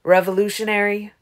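loud, indignant challenge; mild growl. A narrator's reading. Garrett answers Aro's label, growling. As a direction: throw the word back with an angry edge. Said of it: "he growled".

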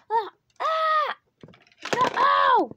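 loud high scream, then cry of no; startled yell as you fall; panicked screaming. Real, not acted. Character falling, screaming.

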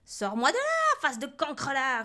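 very high, loud furious yell; screeched angry command; roaring witch. A narrator's reading. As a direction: roar the order in a rage. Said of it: "rugit Cornebidouille".

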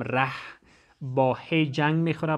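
normal talking voice

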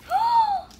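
big surprised gasp; sharp gasp. Real, not acted. Reacting to candy mess.

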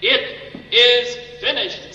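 loud, high, drawn-out cry; tremendous voice. Retold or imitated. Preacher voicing Jesus crying out from the cross, tremendous voice. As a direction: let each word resound, slow and powerful. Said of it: "this tremendous voice comes out".

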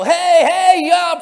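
normal talking voice

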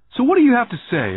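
acted boyish defiance; bold demand. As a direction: demand an answer, pitched well up. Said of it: "I demanded".

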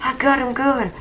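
high-pitched gleeful crowing, loud then easing; excited celebration. Real, not acted. Player boasting he got him good, excited crowing. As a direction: crow over the kill in a high voice.

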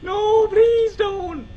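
high, drawn-out begging; wailing desperate plea; panicked pleading. Real, not acted. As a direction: cry out high, then sink to a plea. Power nearly out, pleading.

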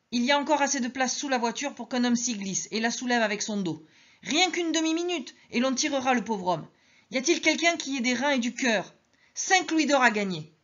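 high, eager call for a volunteer; urgent offer. Acted. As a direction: Madeleine offering gold to save the old man, urgent. Madeleine offers reward.

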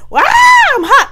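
high loud slow holler. Retold or imitated. Alone at the sink, a loud high holler. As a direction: yell it out to nobody. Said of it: "I don't know why I'm hollering".

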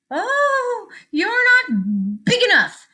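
high, drawn-out cowardly excuse; nervous backing down. A narrator's reading. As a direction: back off slowly in a high voice. Grouchy ladybug backing down from sparrow.

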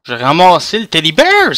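ordinary talk, nothing marked

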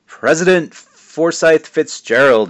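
slow, high, loud proclamation; grand announcement. Acted. Proud dad proclaiming son's future title, slow and grand. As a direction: announce the name like carving it in stone. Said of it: "pointing at the spot each word".

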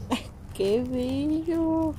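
high, soft, slow coo. Real, not acted. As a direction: coo softly at the puppy. Cooing at the dog.

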